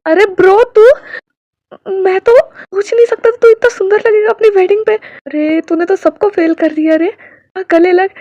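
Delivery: high, loud, thrilled friend's welcome; excited greeting. A narrator's reading. Friend hugging the groom, loud and thrilled. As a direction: burst in overjoyed to see the groom.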